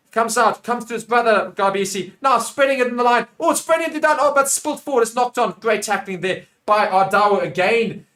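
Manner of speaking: high, loud rush as the ball is spread; animated match commentary; excited play-by-play. Real, not acted. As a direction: call it breathlessly fast-moving, then settle. Live play-by-play.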